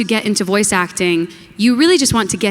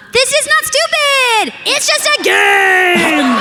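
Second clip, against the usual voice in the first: high shrill character yelling; character line shout; slower than usual. Pretend. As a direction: shout it like an upset cartoon. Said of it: "your favorite lines".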